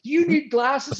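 high-pitched mock angry jab; retold taunt. Replaying the coach's glasses jab to friends, mock angry.

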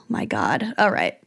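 breathless whispered oh my God; barely a breath, spent from laughing. Real, not acted. Exhausted after laughing.

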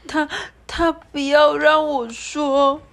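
high, slow, hesitant little voice; almost too afraid to say it; 怯生生的小孩声音; scared child. Acted. Scared child hiding under blanket.

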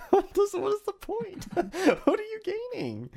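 mock exasperation. Mocking people who fight on Twitter all day, high and incredulous. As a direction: ham up baffled disbelief at the Twitter arguers. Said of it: "I'm like".

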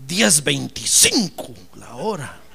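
drawn-out, savoring the number; a hint of a pompous boss; slow boss voice. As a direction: announce the wage slowly. Boss announcing new wage.

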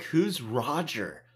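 slow, drawn-out counselor quote; hammed-up incredulous counselor; mock denial. Imitates counselor denying camper exists.

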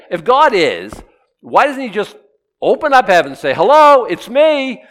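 loud, high mocking skeptic; a high, loud mocking demand. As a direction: throw the skeptic's challenge out loud.

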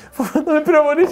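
high-pitched mock fear; Τέξας. Scared friend fears being shot by police, hammed-up high voice. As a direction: squeak it like a friend frozen with fright. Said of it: "ο Τέξας από την άλλη και λέει".

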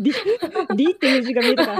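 laughing through the explanation. Real, not acted. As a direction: explain it while cracking up. Laughing over a misread word.

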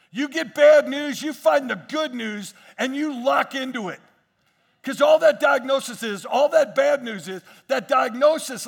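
loud, rising preacher's call to the congregation; full-force preaching. Real, not acted. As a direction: preach it out, pressing every line.

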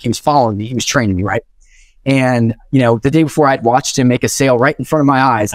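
ordinary delivery

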